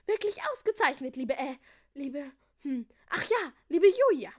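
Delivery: high praise stalling on the name; doddery old king. A narrator's reading. Old king forgetting Julia's name, halting then triumphant.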